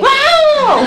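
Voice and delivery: very high, comic scream; mock startled yell. Husband going to hug wife at bedtime, mock startled yell. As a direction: imitate a husband shrieking in fright. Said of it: "dia teriak".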